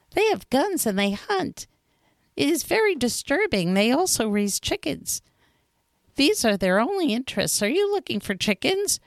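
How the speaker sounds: fox character voice. A narrator's reading. Fox tells the little prince about men, in a high fox voice. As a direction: play the sly fox complaining about hunters.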